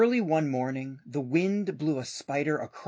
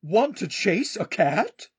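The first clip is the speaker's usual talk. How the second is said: slow dog voice; 4 semitones higher, 5 dB louder, slower than usual. Acted. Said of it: "barked the dog".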